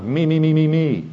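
high, run-together chant of me's; mock self-centredness. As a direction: mimic a self-obsessed inner voice. Mimics self-centred inner thoughts.

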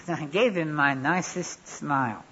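ordinary talk

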